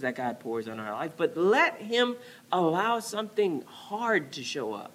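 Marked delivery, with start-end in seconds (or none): none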